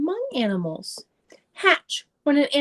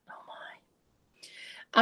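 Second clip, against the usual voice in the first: hushed, breathless whisper of awe; 10 dB quieter than usual. Pretend. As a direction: whisper it like a secret to children. Reacting to octopus ink.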